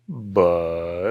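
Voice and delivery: slow, low, then swooping upward; drawn-out disbelief. Retold or imitated. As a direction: stretch it into a baffled question. Retold stunned reaction in his head.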